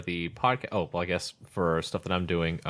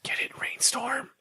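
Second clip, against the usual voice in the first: breathy stage whisper, higher and teasing; playful whisper. Real, not acted. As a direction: whisper the joke like a secret. Sly aside about the sponsor.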